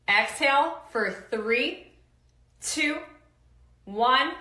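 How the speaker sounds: drawn-out coach countdown; coaching count. Shown as a demonstration. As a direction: pace them through the count. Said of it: "Let's try it one more time".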